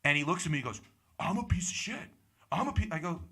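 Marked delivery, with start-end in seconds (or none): 1.2-2.9 high-pitched incredulous echo of the insult; mock indignant. Comic imitates heckler reacting to his insult, mock indignant. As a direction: imitate an offended heckler squeaking up in disbelief.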